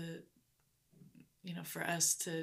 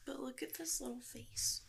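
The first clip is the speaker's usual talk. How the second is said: whispering; 8 semitones higher, 6 dB quieter than usual. Real, not acted. Cooing over ultrasound picture.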